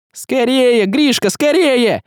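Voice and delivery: high, frantic urging; urgent exclamation. A narrator's reading. Василий is chased by the lynx, urgent high cries. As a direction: cry out to hurry, drawing out the words. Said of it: "воскликнул Василий".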